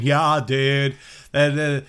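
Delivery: put-on a half-hearted Californian surfer dude accent; mock surfer dude accent, drawn out; bad surfer dude accent. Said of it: "that was the worst fucking accent ever".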